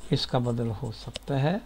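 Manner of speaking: normal talking voice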